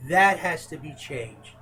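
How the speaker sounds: ordinary delivery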